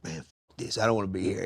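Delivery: low, soft grumble to yourself; mock grumpy. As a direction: imitate being fed up on a bad day. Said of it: "that day to be mad".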